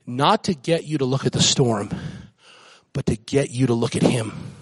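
usual speaking voice

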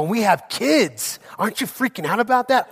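playful freaked-out question to his wife; mock panic. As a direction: ham up a rising alarm. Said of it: "And I go".

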